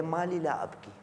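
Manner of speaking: soft, mournful quoted supplication; retold weeping lament. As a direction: voice the Imam's grief in a hush. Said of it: "जारो कतार रो रहे हैं".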